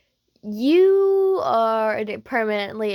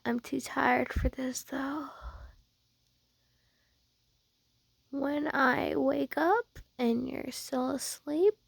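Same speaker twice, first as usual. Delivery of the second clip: soft, slow, drowsy tease; sleepy teasing murmur; 4 semitones higher, 7 dB quieter than usual. Acted. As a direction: murmur a lazy threat.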